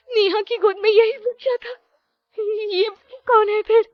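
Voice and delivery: very high, loud, frightened; panicked. Acted. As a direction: cry out in rising terror. Priya sees the ghost child. (दहशत में बहुत ऊँची, तेज़ आवाज़)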